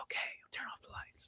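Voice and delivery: very soft, quick whispered reply. Acted. Alexa answering in whisper mode, whispering back very softly. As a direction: whisper back barely audibly, as the voice assistant. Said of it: "Would you like me to whisper back?".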